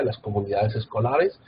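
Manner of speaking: ordinary talk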